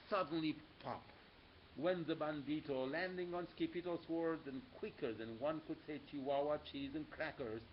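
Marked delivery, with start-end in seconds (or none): none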